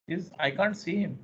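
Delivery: normal talking voice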